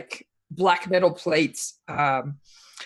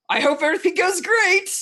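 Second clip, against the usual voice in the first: higher-pitched, hopeful send-off; chirpy well-wishing; 11 semitones higher than usual. Acted. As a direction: lift it high, acting out a cheery well-wisher. Acting out Sean calling from outside.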